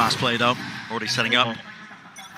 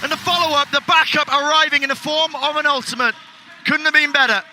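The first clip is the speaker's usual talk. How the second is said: screaming hype call, much higher and louder; esports caster; 13 semitones higher, 4 dB louder than usual. Real, not acted. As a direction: lose it over the clutch. Caster erupts at a clutch play.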